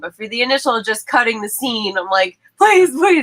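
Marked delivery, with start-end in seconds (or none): 2.6-3.2 pleading. Retold or imitated. Begging him to use headphones.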